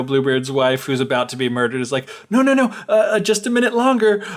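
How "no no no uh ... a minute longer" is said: high, stammered stalling; acted flustered plea, pitched up; mock pleading. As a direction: imitate a wife begging for time. Retold wife pleading for time.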